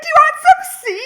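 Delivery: very high, loud, rushed mock squeal; imitating someone bumped up. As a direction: fire off the offer like someone wired, hammed up. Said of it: "she's, like, all bumped".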